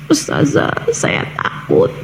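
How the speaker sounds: breathy, near-whispered fearful confession; mock scared voice, mostly breath; imitated frightened girl. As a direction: gasp it out softly like a scared caller. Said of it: "dia takut".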